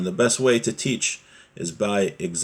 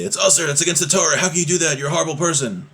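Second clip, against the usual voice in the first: mock yelling; 9 semitones higher, faster than usual. Parent yelling at family over forbidden speech, mock yelling. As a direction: yell an indignant rebuke in mock outrage. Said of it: "you don't just yell at them".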